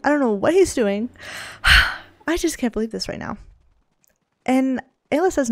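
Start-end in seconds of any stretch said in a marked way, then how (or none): 1.1-2.0 loud, breath-only huff; exasperated sigh. Real, not acted. Player cannot believe what Tyler is doing, exasperated sigh. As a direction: huff out hard.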